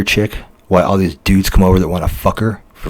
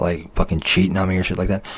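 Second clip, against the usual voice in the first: usual speaking voice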